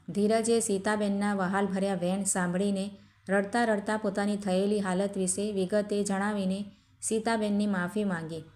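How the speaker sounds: plain reading voice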